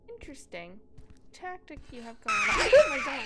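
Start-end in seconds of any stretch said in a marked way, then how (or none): 2.2-3.3 very high, loud shriek; terrified scream. Real, not acted. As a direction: scream from a sudden scare. Sudden jump scare.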